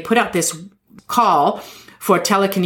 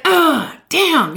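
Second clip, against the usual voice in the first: mock frustrated groan; 9 semitones higher than usual. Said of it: "she's just like".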